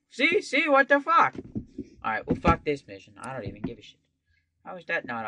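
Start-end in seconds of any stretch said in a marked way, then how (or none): 0.1-1.4 high alarmed yelp. Real, not acted. Alarmed at game.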